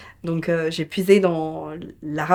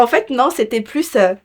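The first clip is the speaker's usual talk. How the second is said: ordinary talk, nothing marked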